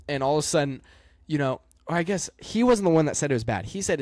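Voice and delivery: usual speaking voice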